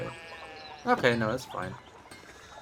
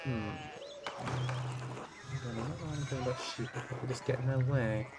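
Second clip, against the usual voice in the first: hushed doubtful whisper about the sheep; whispered aside; 3 semitones lower, 9 dB quieter than usual. Acted. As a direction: breathe it to yourself so nobody hears. Muttered aside to himself.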